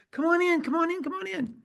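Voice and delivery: high-pitched, rapid-fire welcome; eager beckoning. Retold or imitated. Host beckons a hesitant student into the suite, eager beckoning. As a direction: wave them in with a high, insistent call.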